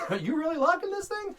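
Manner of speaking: very high, whiny complaint; high whiny crew member. Retold or imitated. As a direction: squeal it like a panicked crew guy.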